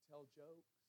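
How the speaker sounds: ordinary talk, nothing marked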